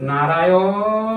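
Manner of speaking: calling নারায়ণ with the vowels stretched long; নারায়ণ called long and pleading; drawn-out call. Retold or imitated. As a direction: draw out নারায়ণ like a desperate call. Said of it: "আবার কেমন উচ্চারণ করেছে?".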